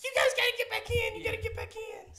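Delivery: high, fast panicked mom voice. Retold or imitated. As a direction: squeak it out like a frantic mom. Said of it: "your mom was like".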